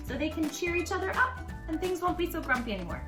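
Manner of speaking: ordinary delivery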